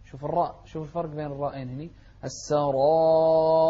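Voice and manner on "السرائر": long dark aa, thin final r in السرائر; heavy and light raa. Shown as a demonstration. Teacher contrasting both r sounds, السرائر loudly chanted. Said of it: "واحدة مفخمة، واحدة مرققة".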